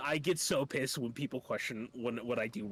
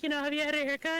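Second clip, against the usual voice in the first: very high, loud nagging falsetto; mocking the aunt asking about a haircut; 5 dB louder, faster than usual. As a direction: squeak it like a nosy aunt. Imitating aunt.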